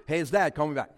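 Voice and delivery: loud first half, quick drop after; insistent dad. Acted. Dad calling his kid who won't answer, insistent. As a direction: punch out a voicemail to the kid.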